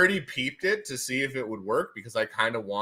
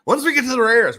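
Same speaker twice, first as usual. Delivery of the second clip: excited build-up; 12 semitones higher, 8 dB louder than usual. Acted. Repeats it louder and higher.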